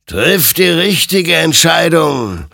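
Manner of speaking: slow, high, loud warning; ringing, drawn-out exhortation; stern admonishment. A narrator's reading. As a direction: admonish like an otherworldly judge. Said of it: "ermahnte ihn sein Gegenüber".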